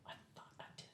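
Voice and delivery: tiny, barely audible whisper; sheepish whisper. Acted. Admitting a mistake while hiding, sheepish whisper. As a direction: whisper it as a weak excuse.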